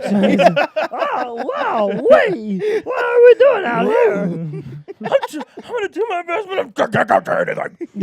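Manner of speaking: high, loud, cracking screech; hammed-up panicked cashier; frantic squawking cashier. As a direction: lose it, stammering high. Cashier voice continued.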